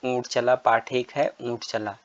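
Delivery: ordinary talk, nothing marked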